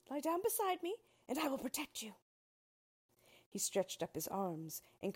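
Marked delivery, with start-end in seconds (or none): 0.1-2.1 much higher scarecrow voice; protective Scarecrow. A narrator's reading. Scarecrow telling Dorothy to lie down, high and urgent. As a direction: urge her down to safety.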